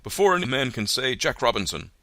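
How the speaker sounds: rally the lads; jovial cry. A narrator's reading. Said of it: "cried old Fezziwig".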